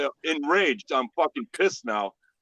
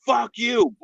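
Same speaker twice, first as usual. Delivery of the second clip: higher mock-yelled insult; imitated angry retort; 9 semitones higher, 4 dB louder than usual. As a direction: throw it back like the raging intruder. Intruder's retort, acted.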